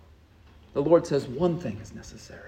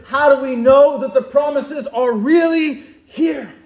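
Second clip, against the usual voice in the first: rising, louder with each question; preaching; 2 semitones higher, 8 dB louder than usual. Acted. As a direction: build the questions to a climax. Preacher builds rhetorical questions.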